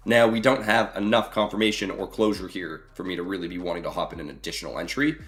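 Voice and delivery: usual speaking voice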